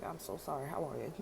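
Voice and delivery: soft, quick mock condolence; hushed condolence. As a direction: murmur the sympathy under your breath. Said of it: "waiting for somebody to be like".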